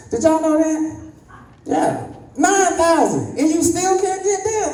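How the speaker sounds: high slowed incredulous exclaim; mock disbelief. Asking the crowd about nerve endings, in high mock disbelief. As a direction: stretch it out high in mock shock. Comic outrage at audience.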